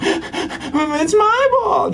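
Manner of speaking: put-on very high, very slow toddler wail; whiny toddler. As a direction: stretch it out like a two-year-old who wants the ball. Toddler grabbing ball, acted.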